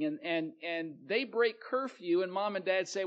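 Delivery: ordinary delivery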